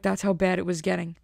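ordinary talk, nothing marked

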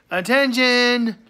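loud, high call for attention. Real, not acted. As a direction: call it out like an announcement. Calling out before a shout-out list.

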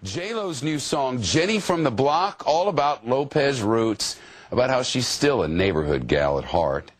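news read. Acted. Anchor reading a news story.

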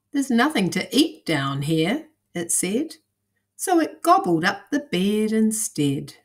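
put-on lively storybook narration for kids; reading to children. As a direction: read it like a bedtime picture book. Reads picture book to children.

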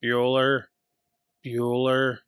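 low monotone, drawn-out roll call; Ben Stein. Acted. Teacher calling an absent student's name, deadpan drone. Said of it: "Are you Ben Stein from Ferris Bueller?".

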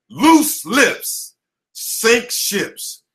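stretched out, word by word, like a motto; very slow slogan. Acted. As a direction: drag each word of the saying. Slogan drawn out slowly.

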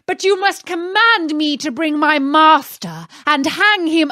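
angry genie. Acted. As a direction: scold Aladdin as the outraged genie. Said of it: "loud and terrible shriek".

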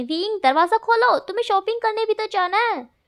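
loud, high call through the door; shouting. Acted. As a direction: yell at him to wake up. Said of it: "चिल्ला रही है". (ऊँची आवाज़ में चिल्लाकर)

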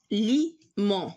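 اليمون split slowly into a, li, mon; slow اليمون with gaps between syllables; slow dictation. Shown as a demonstration. Teacher sounds out word while writing.

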